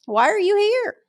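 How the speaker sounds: high, slowish scolding voice to a drinker; terrible bartender scolding. Retold or imitated. Bartender scolding a drinking customer, high mock nag. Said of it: "I'd just be there going".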